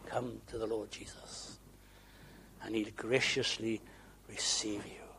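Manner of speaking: low, soft, hushed assurance; gentle invitation. Real, not acted. Preacher assuring seekers they will be received, gentle hush. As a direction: drop to a tender hush, with pauses.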